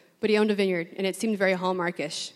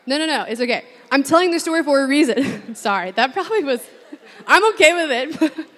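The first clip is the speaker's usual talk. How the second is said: louder, laughing through apologies; laughing apology; 6 semitones higher, 7 dB louder than usual. Real, not acted. Apologizing to the room through laughter. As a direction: apologize while still cracking up.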